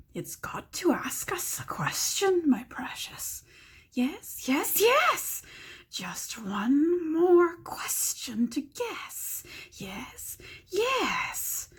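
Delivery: high hissing whisper of a hungry creature; Gollum voice. Acted. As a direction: whisper it greedily, like a slimy cave creature. Gollum voice in read story.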